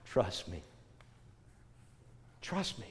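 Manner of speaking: very soft, gentle 'trust me'; a soft, patient plea to trust; God's quiet reassurance. Acted. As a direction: say it hushed, letting each one sink in. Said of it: "he's trying to say".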